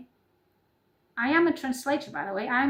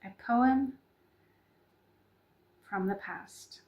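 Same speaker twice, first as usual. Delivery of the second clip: poem introduction; 3 dB quieter, slower than usual. Acted.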